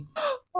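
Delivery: high sharp gasp of dismay; a quick, high intake of breath; sudden shocked gasp, very high. Real, not acted. Sudden realisation at end.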